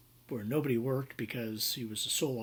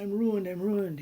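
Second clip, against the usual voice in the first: high, louder mock wail of ruin; hammed-up despair, pitched up; 7 semitones higher, 5 dB louder than usual. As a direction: imitate a client panicking that all is lost. Client despairs before calling lawyer.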